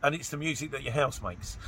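usual speaking voice

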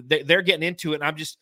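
ordinary talk, nothing marked

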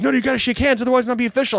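high-pitched, insistent mock official; high, urgent correction; fussing match officials. Acted officials scolding him after win.

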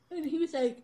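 usual speaking voice

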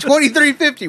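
high, flat cashier voice; cashier announcing price. Retold or imitated. Cashier to the customer at the window, mock flat. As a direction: state the price like a bored cashier.

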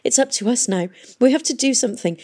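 acted half-sobbed urgency; sniffling. A narrator's reading. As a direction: insist through the tears. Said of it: "she sniffled".

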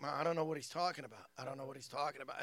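quick, low-key denial; mock-innocent brother, fast and understated; feigned innocence. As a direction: brush it off quickly and softly. Brother innocently denying it to mother.

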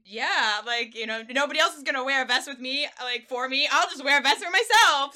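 loud, pumped-up pep talk; excited self-talk. Retold or imitated. Hyping himself up to wear vest.

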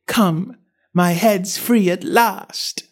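high, drawn-out cry of relief; tone of delight. A narrator's reading. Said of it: "in a tone of delight".

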